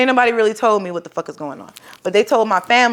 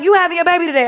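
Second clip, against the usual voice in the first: insistent call; 8 semitones higher, faster than usual. Retold or imitated. Nurse insisting.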